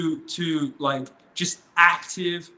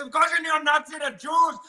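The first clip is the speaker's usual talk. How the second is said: mock shouted Indian subcontinent accent, pitched high; lightly hammed-up Indian subcontinent accent, higher and louder; 13 semitones higher, 4 dB louder than usual. The stranger insisting to the speaker, in a high mock Indian subcontinent accent. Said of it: "I went a bit Indian subcontinent there".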